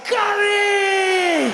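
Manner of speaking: high, loud joyful scream; ecstatic shout. Retold or imitated. Teller loving the curry, loud high joyful scream. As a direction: cry the word in pure delight. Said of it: "I was like".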